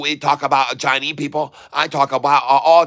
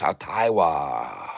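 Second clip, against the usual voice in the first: deep mock name drop; 8 semitones lower, 6 dB quieter, slower than usual. Names Catfish, Taiwan in deep voice.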